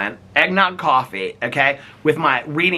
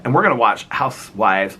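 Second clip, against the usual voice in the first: ordinary delivery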